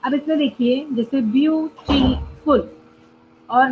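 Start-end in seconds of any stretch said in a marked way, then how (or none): 1.2-2.8 beautiful broken into three separate syllables. Shown as a demonstration. Teacher showing three-syllable words, beautiful split by syllable. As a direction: say beautiful in three parts, pausing twice. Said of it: "दो बार break करना हो रहा है".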